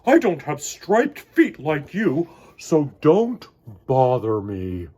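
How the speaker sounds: loud grumpy bark; grumpy walrus. Acted. Missus Walrus grumping at Choco, loud. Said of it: "grumped Missus Walrus".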